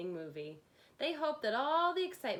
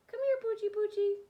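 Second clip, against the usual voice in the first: puppy talk; 12 semitones higher, 6 dB louder than usual. Acted. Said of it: "whispered the other".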